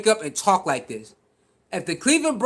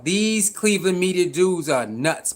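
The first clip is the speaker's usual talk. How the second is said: ordinary talk, nothing marked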